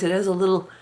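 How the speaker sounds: normal talking voice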